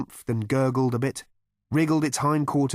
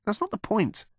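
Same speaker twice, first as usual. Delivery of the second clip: much higher, fretful protest; a mild fussy protest; protesting Arthur; 12 semitones higher than usual. A narrator's reading. Arthur protesting to Zaphod, in a much higher fretful voice. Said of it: "Arthur protested".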